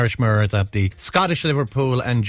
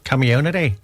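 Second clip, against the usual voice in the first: Northern Irish accent. Shown as a demonstration. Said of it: "Can you do the Northern Ireland accent?".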